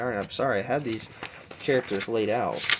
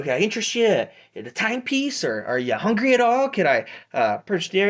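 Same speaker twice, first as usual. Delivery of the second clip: high, loud halfling salesman; Pete; 9 semitones higher, 5 dB louder, faster than usual. Acted. Halfling peddler offering watches and snacks, eager sales pitch. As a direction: push the sale in a raised voice. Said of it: "my name's Pete".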